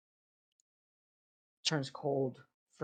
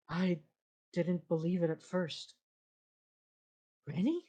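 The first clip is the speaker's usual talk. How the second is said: calm disembodied voice; 4 semitones higher than usual. Acted. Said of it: "the calm, disembodied voice, which goes".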